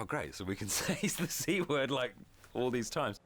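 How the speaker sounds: gleeful high-pitched mock delight; excited. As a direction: play up the naughty thrill. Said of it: "so we were like".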